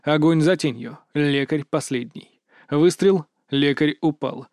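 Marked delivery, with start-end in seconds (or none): none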